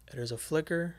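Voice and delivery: normal talking voice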